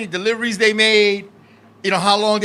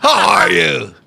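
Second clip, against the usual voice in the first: halting dubbed martial-arts greeting; slow, stilted kung-fu film delivery with long pauses; mock kung-fu movie voice; 3 semitones lower, much slower than usual. Boyfriend greeting girlfriend's father, mock kung-fu voice. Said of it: "I went up to him, I was like".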